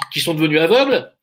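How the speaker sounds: ordinary delivery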